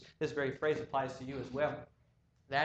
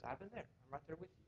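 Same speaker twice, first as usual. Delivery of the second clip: soft wife voice; 16 dB quieter, much faster than usual. Retold or imitated. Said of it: "She goes".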